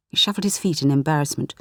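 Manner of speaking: ordinary delivery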